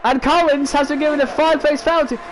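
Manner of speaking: usual speaking voice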